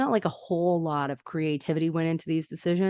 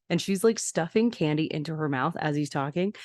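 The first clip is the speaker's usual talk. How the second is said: ordinary talk, nothing marked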